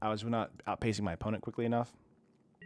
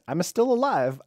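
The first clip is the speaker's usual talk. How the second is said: mock Italian accent, higher and louder; 8 semitones higher, 8 dB louder than usual. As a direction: ham up a Italian accent, singing it out. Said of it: "I said it like an Italian there".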